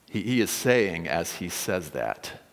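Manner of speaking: ordinary delivery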